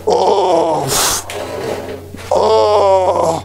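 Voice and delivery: gagging at a foul smell; real retching from the stench; dry heaving. Real, not acted. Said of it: "je vais vomir".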